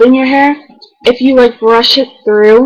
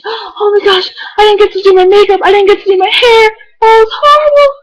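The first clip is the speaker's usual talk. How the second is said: much higher tearful wail; upset crying; 10 semitones higher than usual. Retold or imitated. Upset friend before the bus, imitating a high tearful wail. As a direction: cry out in distress, voice breaking. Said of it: "she's like".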